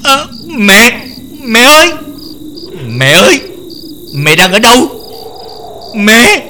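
put-on a scared girl shouting for her mother; frightened crying out. Girl tied to a tree, calling her mother in terror. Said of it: "rồi sợ hãi kêu to".

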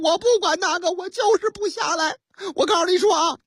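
高调门不依不饶地顶撞; 演司机蛮横地抢白警察; 激动耍横. Acted. 司机与警察争吵拒不下车. (拔高嗓子争吵)

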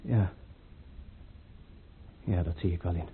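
a stunned, hushed admission; dismayed realisation. Acted. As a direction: let the words fall away in quiet dismay. Prince realises he was used.

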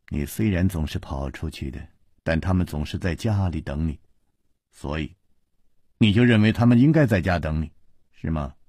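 ordinary delivery

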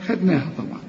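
ordinary talk

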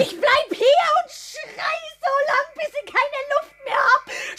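tearful threat, voice cracking with a laugh; crying, half-shouting. Acted. Pregnant woman tearfully threatening to scream at her lover. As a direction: cry and shout through it.

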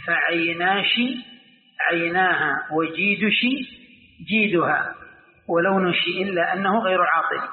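slow recitation with shi endings in "فعيناشي" and "وجيدشي"; Kashkasha verse. Shown as a demonstration. Teacher quoting the poet's example, slow Kashkasha shi endings. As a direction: recite "ولونشي" with shi in place of the k. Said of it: "لغة الكشكشة".

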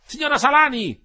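very high, loud hail; calling from the street. A narrator's reading. Young Renzoni hails Ersilia from the street, calling up. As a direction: shout up to a window to get attention. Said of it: "disse costui dalla strada".

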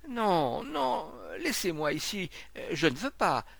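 high, pleading refusal; Monsieur Rodiguet, terrified. A narrator's reading. Sick man refusing to be carried inside, terrified pleading. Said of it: "une épouvante crispait sa face".

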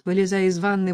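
usual speaking voice

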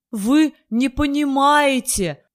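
slow, high sobbing cry; crying on the phone. A narrator's reading. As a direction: burst into tears down the phone. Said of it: "разрыдался ... в трубку".

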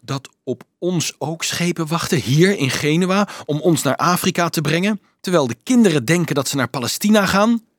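mildly horrified, rising urgency; dismayed. A narrator's reading. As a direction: let the horror push the question upward. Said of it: "fluisterde Dolf ontzet".

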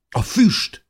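high, loud cry of alarm; urgent exclamation. A narrator's reading. Narrator warns the others about smoke, exclaiming urgently. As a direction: call out the danger suddenly.

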